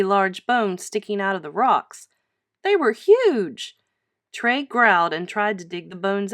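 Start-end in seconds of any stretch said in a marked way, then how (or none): 2.6-3.8 amazed. A narrator's reading. Narrator marvels at huge bones.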